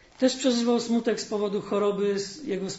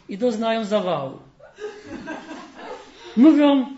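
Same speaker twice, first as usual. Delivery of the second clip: lively storyteller voice, livelier than the sermon; fairy tale reading; 2 semitones higher, 4 dB louder than usual. Acted. Preacher reading a fairy tale to the congregation, storytelling. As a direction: read the tale warmly to the congregation.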